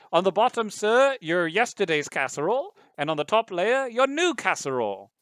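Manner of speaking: high, loud presenting tone; computer butler voice. Acted. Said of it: "and it says".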